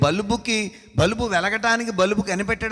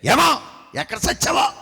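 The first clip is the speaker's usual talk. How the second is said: a sharp, higher shouted retort; snapping back; 6 semitones higher than usual. Retold or imitated. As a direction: fire it straight back at him. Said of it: "ఒకేసారి అతను అంత స్పీడ్గా అంటే".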